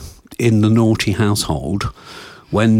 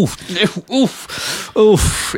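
disgusted oof; 12 semitones higher, much slower than usual. Real, not acted. Disgusted at the bed image.

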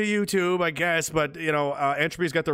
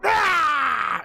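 higher, loud sudden battle cry; mock attack yell; 8 semitones higher, 4 dB louder than usual. Streamer acting out attacking his imagined girlfriend, a loud sudden yell. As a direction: lunge with a yell.

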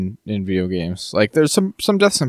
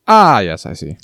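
delayed realisation; 2 semitones lower, 3 dB louder than usual. Acted. Viewer finally piecing together a garbled line, mock realisation. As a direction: act out the penny finally dropping. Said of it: "interpret what he was saying".

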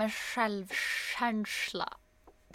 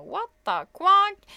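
high, slow, drawn-out baffled exclamation; a put-on voice of stunned confusion; mock shock; 10 semitones higher, 8 dB louder, much slower than usual. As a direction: stretch it out in disbelief. Waking up alone, confused.

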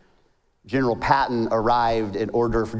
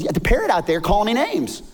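higher-pitched, indignant complaint; frustrated customer; 9 semitones higher, 3 dB louder than usual. Acted. Man complains to manager about the parrot, indignant. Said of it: "he gets a little frustrated".

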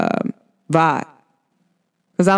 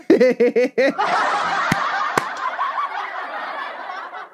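laughing; 5 dB quieter than usual. Real, not acted. Laughing at her own math joke.

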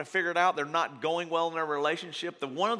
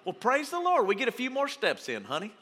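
lightly hammed-up chirpy gratitude, pitched higher; mock cheerful praise; 6 semitones higher than usual. A spouse on the long walk, in mock cheer. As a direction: fake a sunny thank-you to your honey. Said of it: "you're like".

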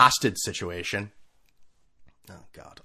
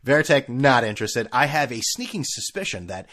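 ordinary talk

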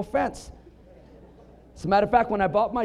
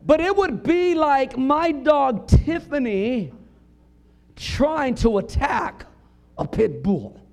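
slow, emphatic preacher build; preaching; 6 semitones higher, 5 dB louder, slower than usual. Acted. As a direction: stretch it out to land the image.

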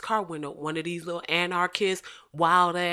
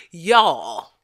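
ordinary delivery

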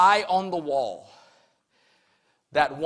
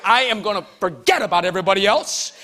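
normal talking voice

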